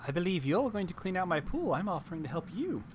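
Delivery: put-on scatterbrained old hag voice; erratic old frog hag. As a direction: play the hag, erratic and chatty.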